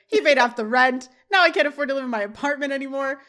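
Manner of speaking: loud, hammed-up lament; mock anguished shouting. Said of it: "Jack screams".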